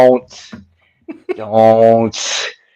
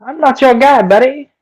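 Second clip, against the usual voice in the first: much higher, squeaky cartoon Canadian accent; South Park Canadian; 13 semitones higher than usual. Quoting South Park to friends, squeaky high Canadian voice. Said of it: "South Park ... make fun of Canadians".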